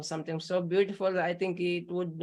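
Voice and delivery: ordinary talk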